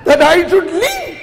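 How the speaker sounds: high, rising, emphatic acted proclamation; fervent announcement. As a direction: build to a very high-pitched shout. Abraham announces his departure to family.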